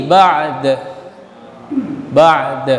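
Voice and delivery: ba'd with no final vowel, bouncing d; stopped ending. Shown as a demonstration. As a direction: stop on the d of ba'd. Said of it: "Kalau diwakaf dia mah mati". (ba'd diwakaf, huruf dal mati)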